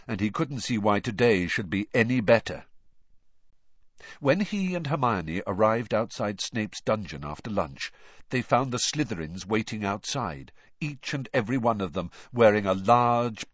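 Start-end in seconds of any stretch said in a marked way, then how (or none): none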